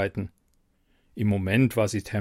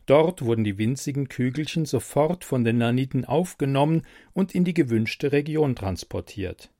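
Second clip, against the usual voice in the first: usual speaking voice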